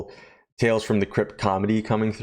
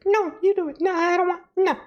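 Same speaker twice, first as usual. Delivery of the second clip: very high falsetto refusals; squeaky, girlish digging in; reluctant showgirl. Retold or imitated. Showgirl refuses to pull the lever, high falsetto protest.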